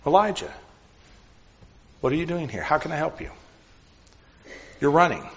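soft, quiet and caring, not a rebuke; gentle caring question. Shown as a demonstration. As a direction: ask it gently, offering help. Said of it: "It was a question".

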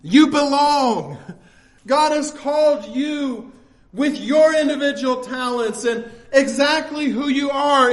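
normal talking voice

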